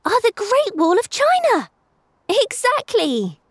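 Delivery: put-on high boy's voice, triumphant; a kid blurting out the answer; excited boy. A narrator's reading. Obi interrupts, solving riddle.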